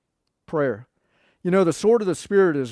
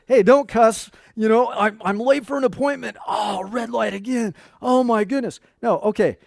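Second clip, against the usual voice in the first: frustrated driver; 5 semitones higher, 5 dB louder than usual. Acted. Frustrated late driver at red light.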